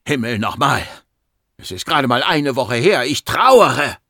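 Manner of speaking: angry protest building to a loud cry; exasperated grief. Acted. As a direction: burst out, loudest on the last words. Grieving patient shouts at doctor. (aufgebracht, zum Schrei gesteigert)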